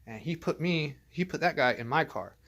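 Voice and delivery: ordinary delivery, nothing marked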